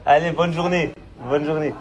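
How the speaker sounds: usual speaking voice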